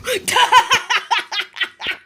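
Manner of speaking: long high staccato giggle; giggling. Acted. Giggling after lion's noise.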